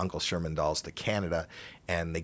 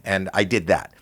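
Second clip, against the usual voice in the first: ordinary talk, nothing marked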